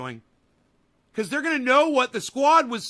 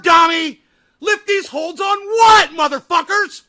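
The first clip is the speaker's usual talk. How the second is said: screaming at the top of his lungs; furious yelling; 4 semitones higher, 9 dB louder than usual. Real, not acted. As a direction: tear into Dom, full volume. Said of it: "Oh, wait. I'm yelling again, aren't I?".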